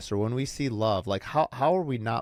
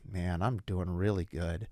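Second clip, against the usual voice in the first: gloomy good news; 4 semitones lower, 5 dB quieter, slower than usual. Retold or imitated. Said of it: "I'm taking the two things and mixing 'em".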